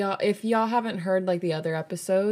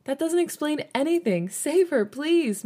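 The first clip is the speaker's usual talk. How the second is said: high desperate plea; begging; 10 semitones higher, 3 dB louder than usual. A narrator's reading. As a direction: beg for help, voice up high. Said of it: "Minnie begged".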